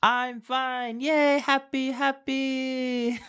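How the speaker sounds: very high sing-song mock cheer; gleeful pupil. Pupil answering the greeting, mock gleeful high voice. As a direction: squeal it like an overjoyed kid, hammed-up.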